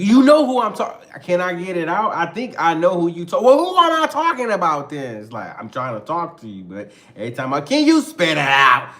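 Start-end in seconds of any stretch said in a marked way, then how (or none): 0.0-5.2 high, loud mock quarrel; heated interrupting. Couple talking over each other about Jody, heated. 7.6-8.9 loud exasperated demand; impatient. Acted. Girlfriend cutting off stammering partner, loud and impatient.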